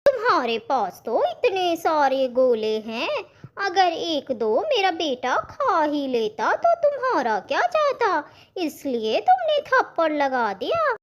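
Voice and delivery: high, swooping argumentative voice; a mom defending her son; indignant mother. Acted. Mother argues with vendor.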